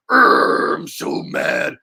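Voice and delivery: low, slow, hammed-up growl of anger; mock angry grumble. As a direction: fake being furious, grumbling. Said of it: "what am I gonna be like".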